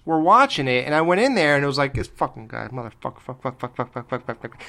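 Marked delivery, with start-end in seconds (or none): 1.9-4.6 low, soft mumbled stream of curses; TV mobster cursing. Retold or imitated. Imitating the TV show to his parents, muttered low cursing. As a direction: rattle off curses like a TV gangster under your breath.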